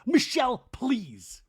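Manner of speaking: high, loud name, then dropping on please; mock exasperated. [name] cutting off Michelle as she tries to talk, mock exasperation. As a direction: imitate an exasperated cut-off.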